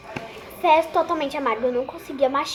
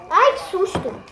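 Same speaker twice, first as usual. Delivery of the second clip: sudden startled cry, higher; 6 semitones higher, slower than usual. Real, not acted. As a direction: jump at a fright. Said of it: "eu tomei um susto com ela".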